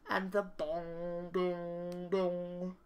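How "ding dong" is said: ordinary delivery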